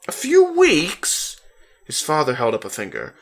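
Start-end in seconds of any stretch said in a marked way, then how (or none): 0.1-1.4 much higher, louder astonished question; stunned at the news; boy shocked to hear how long; shocked disbelief. A narrator's reading. Shocked reaction.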